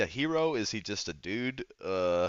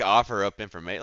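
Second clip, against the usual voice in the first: usual speaking voice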